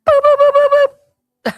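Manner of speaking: high, loud beeping noises for her meltdown; mock alarm beeps. Host mimics her own meltdown to the co-host, high loud beeping. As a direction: squeal out a panic alarm.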